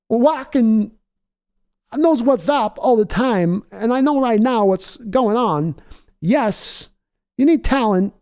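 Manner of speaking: high, slow put-on coach voice talking to Al; walk impression. Co-host mocking the team to Al in a high put-on voice. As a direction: drag the words out in a high mock voice. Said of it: "My walk impression's only good for grumbling".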